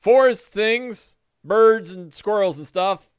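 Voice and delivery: high, loud, hesitant denial; stall, then list things lamely; wide-eyed evasion. Acted. Asked what he saw in the forest, high evasive voice. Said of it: "His eyes kinda go wide".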